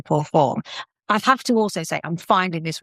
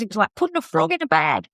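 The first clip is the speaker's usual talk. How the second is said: put-on Aussie accent; 10 semitones higher than usual. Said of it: "he goes".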